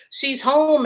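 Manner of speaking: high-pitched, mock holler to others. Truck driver announcing to people behind them, imitated high holler. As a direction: imitate calling out the news, high. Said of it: "He start hollering".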